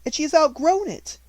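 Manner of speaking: a cold headmistress laying down orders; stern headmistress. A narrator's reading. Headmistress ordering her sister about, stern and loud.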